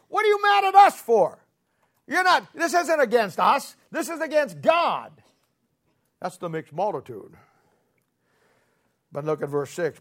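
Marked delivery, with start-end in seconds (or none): 0.1-5.1 much higher and louder, straining as Moses pleads; Moses pleading. Retold or imitated. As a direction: cry it out as an exasperated Moses. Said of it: "And Moses keeps saying".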